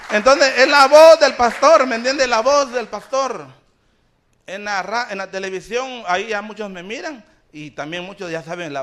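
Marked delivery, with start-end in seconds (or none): 0.1-3.4 loud, high pulpit cry easing off; exclaimed preaching. Real, not acted. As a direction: proclaim the theme to the crowd.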